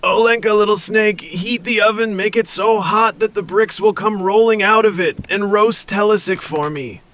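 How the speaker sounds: high, scheming snake voice; snake giving orders. Acted. Snake ordering her daughter to roast the boy, high. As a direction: order your daughter, relishing the feast. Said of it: "she said".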